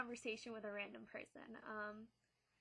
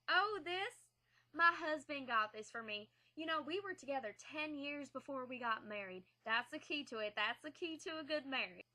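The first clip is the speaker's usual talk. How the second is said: a light imitation of a sweet old lady, higher; sweet Southern lady; 4 semitones higher, 5 dB louder than usual. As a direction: voice her kindly and a bit higher. Stranger's voice quoted.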